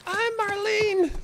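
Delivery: very high, slow squeaky falsetto; high-pitched Marlene voice. Acted. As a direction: squeeze it up into a strained high voice. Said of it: "so it's hard to get my high-pitched voice".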